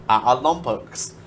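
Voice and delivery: light New Zealand accent on Olympics. Shown as a demonstration. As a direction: say it like a Kiwi. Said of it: "I'm gonna say it in a New Zealand accent".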